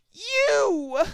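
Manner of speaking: high, loud gasped cry; shocked accusation. A narrator's reading. Trixie spotting the rabbit, high and loud. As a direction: point and accuse.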